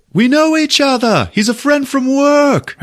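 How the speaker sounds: acting out a high, excited holler; delighted announcement. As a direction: call it out proudly to the whole arena. Thor delightedly calls out to crowd.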